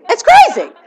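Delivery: high, loud it's crazy; loud, high disbelief; exclaimed. Real, not acted. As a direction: belt it out in disbelief.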